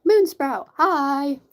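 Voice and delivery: loud, high happy greeting; excited greeting. Real, not acted. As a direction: call out hi with delight. Greeting a new mod loudly.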